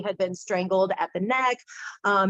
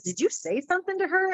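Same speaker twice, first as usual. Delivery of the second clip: higher-pitched mock suspicion; retold startled question; 9 semitones higher than usual. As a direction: quote a taken-aback friend, pitched up.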